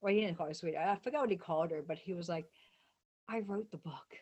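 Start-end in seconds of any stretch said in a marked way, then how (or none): 3.3-4.2 hushed, breathy retelling of his quiet aside; retold whisper. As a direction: drop to a whisper as if letting her in on it.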